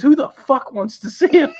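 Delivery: mock outburst. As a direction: fling the line back in disgust. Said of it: "He goes".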